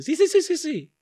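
high, laughing "sí, sí, sí"; mocking imitation. Host mocks the singer's proud yeses, laughing and high. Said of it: "Y tú".

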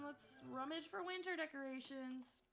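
ordinary talk, nothing marked

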